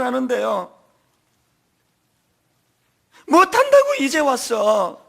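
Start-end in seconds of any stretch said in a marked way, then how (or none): 3.3-5.0 Jeolla dialect wail. Retold or imitated. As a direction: cry it out in thick Jeolla dialect. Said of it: "걸쭉한 전라도 사투리". (전라도 사투리로 통곡하듯)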